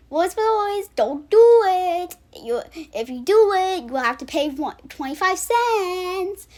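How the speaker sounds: high pleading mock parent, slow; lightly hammed-up worried dad. Imitating Lois's dad begging him not to throw the pencil, high and slow. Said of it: "he was like".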